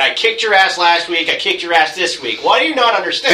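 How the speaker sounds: exasperated yelling. Retold or imitated. Voicing turtles scolding Foot Clan.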